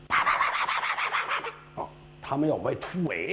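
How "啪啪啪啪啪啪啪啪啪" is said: rapid arrow volley sound. Acted.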